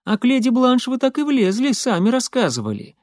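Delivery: high-pitched, reproachful Molly; Molly objecting. A narrator's reading. Молли возражает рассказчику.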